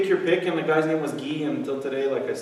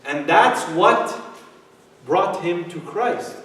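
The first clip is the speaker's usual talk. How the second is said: normal talking voice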